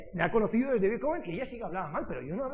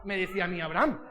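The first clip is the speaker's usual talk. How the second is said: ordinary delivery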